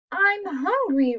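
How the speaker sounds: hammed-up roar for the kids; mild roar. Hungry Bear tells Hare, mild mock roar. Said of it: "roars Bear".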